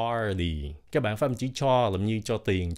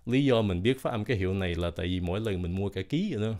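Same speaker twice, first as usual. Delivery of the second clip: ordinary talk, nothing marked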